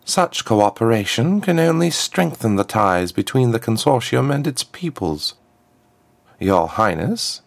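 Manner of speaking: high, smooth voice of a formal diplomat; a smooth ambassador, pitched well up; smooth contralto ambassador. A narrator's reading. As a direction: address royalty smoothly in a high-set voice. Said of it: "her smooth contralto echoing in the hall".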